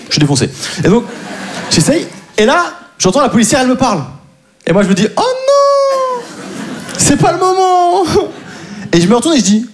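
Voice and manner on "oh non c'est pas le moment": much higher, slow mock panic; panicked inner cry. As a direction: squeal it in comic alarm.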